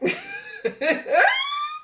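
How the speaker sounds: cackling laugh, high and helpless; shrieking laugh at the scene; big high laugh. Real, not acted. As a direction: burst out laughing hard. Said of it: "I was laughing so hard".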